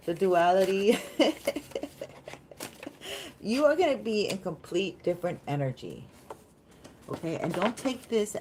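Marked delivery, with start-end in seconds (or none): 1.0-3.4 long, high giggling laugh; amused high-pitched laughter. Real, not acted. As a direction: break into a drawn-out high giggle. Laughs at the duality.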